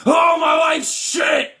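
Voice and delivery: high hammed-up wailing shout; mock sobbing outburst; melodramatic meltdown. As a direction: fake a tantrum over spilled chips. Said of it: "they'll be like".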